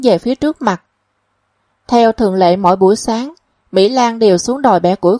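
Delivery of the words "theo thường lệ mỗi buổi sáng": ordinary delivery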